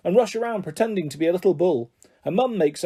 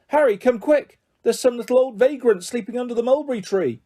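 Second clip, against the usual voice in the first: urgent call; 6 semitones higher than usual. A narrator's reading. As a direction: call Harry over in sudden alarm. Woman calls out in alarm.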